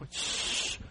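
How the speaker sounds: soft unvoiced shush; calm them with a shush; hushing. God quiets the crying people, soft unvoiced shush.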